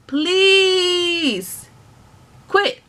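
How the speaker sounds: high, loud please, then quit; pleading shout. Real, not acted. Vlogger telling unhappy workers to quit, loud plea. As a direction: beg them to just quit.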